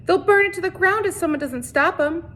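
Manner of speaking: high, frantic pleading; urgent plea. A narrator's reading. Catherine begging the sheriff to stop the mob, high and frantic. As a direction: beg him to act now.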